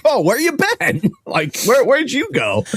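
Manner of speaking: high, innocent voice for the dog; goofy dog voice. Pretend. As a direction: play the clueless happy dog. Voicing the dog's reply.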